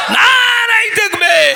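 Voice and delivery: very high, loud, drawn-out cry; full-force rallying yell; slogan shout. Real, not acted. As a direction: shout it out for the whole crowd to answer. Shouts slogan for crowd to answer.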